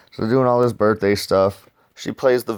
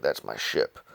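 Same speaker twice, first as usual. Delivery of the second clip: low grumbling Spacely; 10 semitones lower, 9 dB quieter than usual. Retold or imitated. Spacely reacts to stolen ship.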